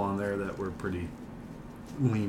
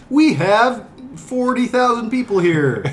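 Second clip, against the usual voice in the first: loud; 12 semitones higher, 7 dB louder than usual. Acted. Mock announcer, laughing at crowd.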